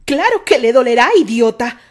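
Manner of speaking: very loud furious snap. A narrator's reading. Isaac snaps at Terry in fury, very loud. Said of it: "lo miraba con furia".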